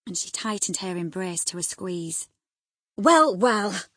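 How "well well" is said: loud, irritated exclamation; cross. A narrator's reading. Husband being squeezed by his excited wife, cross. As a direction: cry it out crossly. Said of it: "cried her husband crossly".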